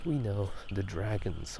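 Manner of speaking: ordinary talk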